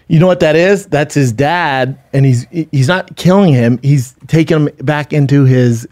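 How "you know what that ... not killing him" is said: ordinary talk, nothing marked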